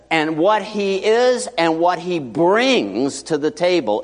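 slow, deliberate, punching the key words; slow emphatic. Real, not acted. Preacher to congregation, slow and emphatic about Christ. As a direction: spell it out slowly, leaning in.

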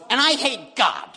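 put-on high childish pout; imitating bratty daughter. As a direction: whine it like a frustrated kid. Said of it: "so she goes".

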